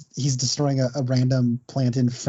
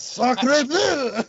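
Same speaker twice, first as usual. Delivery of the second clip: high, exaggerated French exclamation; French accent; 11 semitones higher than usual. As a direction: cry it like a cartoon Frenchman. Joking about the French impression.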